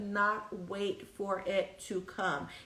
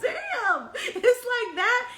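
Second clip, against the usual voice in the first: much higher, laughing, louder mock offense; laughing mock hurt; 13 semitones higher, 6 dB louder, much slower than usual. She answers the husband's insult, laughing high and loud. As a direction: gasp out a laughing fake hurt reply. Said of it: "And I was like".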